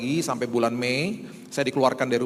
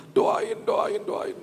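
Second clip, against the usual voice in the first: panicked plea; 8 semitones higher, much slower than usual. Retold or imitated. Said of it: "Dia tuh sampai jerat-jerit".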